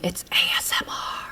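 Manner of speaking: whisper yelling. Real, not acted. Annoyed whisper to Dad.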